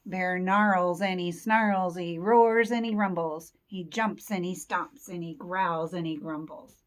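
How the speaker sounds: loud, rumbling-rhythm storytelling; forceful story narration. A narrator's reading. Teacher reads grumpy Bear waking, forceful story narration. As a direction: punch each verb loudly for young listeners. Reads Bear's angry outburst.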